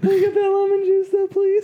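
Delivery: high pleading. Real, not acted. Friend begs the others for lemon juice after whiskey, high pleading whine.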